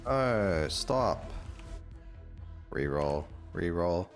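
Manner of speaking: normal talking voice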